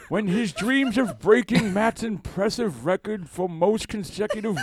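put-on high, slow elderly anchor voice; old newsman voice. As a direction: read the news like an old anchorman. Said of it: "different news anchor voice".

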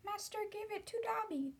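wondering house-elf, high and small; Dobby. Acted. Elf realizes master gave the sock to him, in wonderment. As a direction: pitch it way up as an amazed little elf. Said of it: "said the elf in wonderment".

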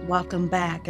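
ordinary delivery

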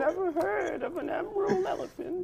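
put-on high, light old woman; old lady voice. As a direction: squeak it out as a frail granny. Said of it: "old lady voice".

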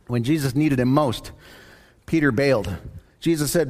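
ordinary talk

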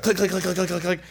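quick mock shutter clicks; camera clicks. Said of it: "and she's like".

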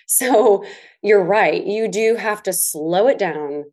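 ordinary talk

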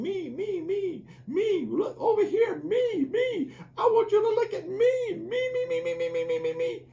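preacher imitating a baby crying for attention; wailing baby. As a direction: whine me me like a hungry infant. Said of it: "his nature saying".

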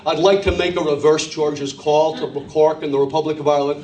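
low, careful American caller; deliberate American phone voice; put-on American accent. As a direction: switch to American to be understood. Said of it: "change your accent and you were there".

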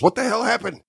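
high, startled demand; a villain caught off guard when the transmission cuts, high mock alarm; mock confusion. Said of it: "Jack goes".

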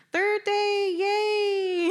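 high-pitched hammed-up cheer; mock cheer. Campers waking on the third day, mock cheer.

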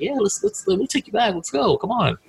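high, pumped-up rallying cry; eager urging. Retold or imitated. Recalling pushing his friend out for a night of drinks, pumped and high. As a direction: hype him up to go out.

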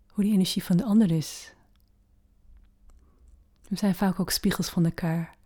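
normal talking voice

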